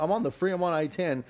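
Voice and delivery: normal talking voice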